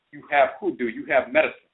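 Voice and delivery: plain reading voice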